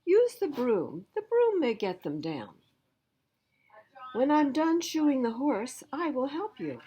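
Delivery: a busy farmer calling back over his work; a farm uncle busy with the horse; uncle's voice. Acted. Uncle gives advice.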